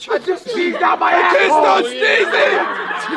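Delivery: yelling through laughter; laughing shout. Real, not acted. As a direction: shout it out, cracking up.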